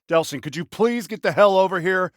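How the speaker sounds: ordinary talk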